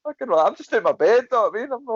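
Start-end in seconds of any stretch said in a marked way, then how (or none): 0.0-0.5 high, heated telling-off; retelling snapping at the loft-ladder guy; exasperated retort. Retold or imitated. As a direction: tell him to calm down, pitched up. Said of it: "I was just like".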